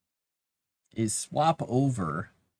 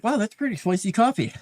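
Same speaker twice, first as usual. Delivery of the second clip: higher, surprised take on spicy coffee; mock surprise; 10 semitones higher, 4 dB louder than usual. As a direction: replay the startled first sip. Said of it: "and I'm like".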